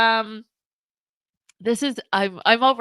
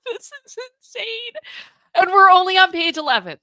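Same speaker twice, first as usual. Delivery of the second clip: amazed outburst; 11 semitones higher, 8 dB louder than usual. Real, not acted. Reacting to shocking texts.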